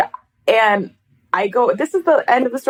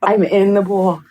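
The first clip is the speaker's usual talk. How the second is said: ordinary delivery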